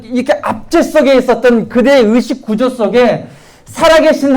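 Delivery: rally the congregation, pressing each phrase; preaching at full force. Acted. Pastor exhorting the congregation on faith, fervent full-force preaching. Sermon climax, louder and higher.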